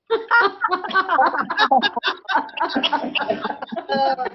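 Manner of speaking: high laughing burst; milkshake laughter. Shown as a demonstration. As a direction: laugh after the pretend drink. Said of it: "and laugh".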